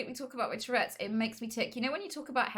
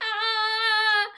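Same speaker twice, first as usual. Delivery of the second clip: high loud startled cry; startled yelp; 13 semitones higher, 11 dB louder than usual. Retold or imitated. Stranger backing away on the train, high loud yelp. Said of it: "backed away like".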